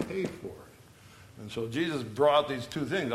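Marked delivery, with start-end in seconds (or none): 0.1-0.5 murmured restatement; soft repeat. Shown as a demonstration. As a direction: pay for it, dropped to a hush. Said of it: "I like to say to pay for".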